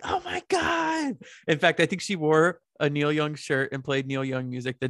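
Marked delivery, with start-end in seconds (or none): 0.0-1.2 gushing. Retold or imitated. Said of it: "she was like".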